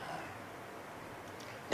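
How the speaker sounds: ordinary talk, nothing marked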